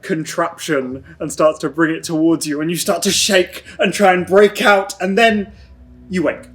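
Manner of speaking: normal talking voice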